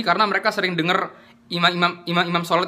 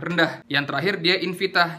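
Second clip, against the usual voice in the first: ordinary talk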